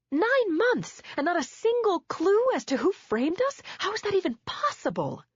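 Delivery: high, loud disbelieving protest; exasperated cry. Acted. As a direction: hurl the question at him. Wife confronting husband.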